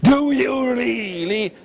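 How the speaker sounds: grave dragon drawing out its doubt; serious dragon. Acted. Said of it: "In serio".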